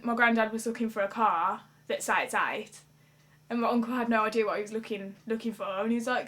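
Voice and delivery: ordinary talk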